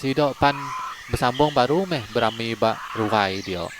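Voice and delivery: ordinary delivery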